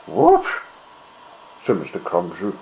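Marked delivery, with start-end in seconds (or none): 0.1-0.5 surprised. A narrator's reading. Mister Clumsy startled outcry.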